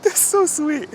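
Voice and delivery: very high, loud gushing; squeaky praising a dog. Real, not acted. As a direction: coo over the sweet dog.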